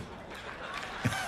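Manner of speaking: short breathy chuckle; a small soft laugh; quiet laugh. Real, not acted. Laughs at his own joke.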